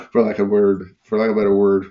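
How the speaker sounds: ordinary talk, nothing marked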